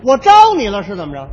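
mock-indignant "did I offend you?"; mock offended retort. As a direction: bristle at the insult. Partner jokes he'll be cremated.

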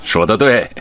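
ordinary talk

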